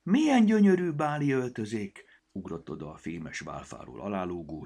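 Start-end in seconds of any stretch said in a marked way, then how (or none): 0.0-2.0 marveling. A narrator's reading. Goblin still marveling at gowns.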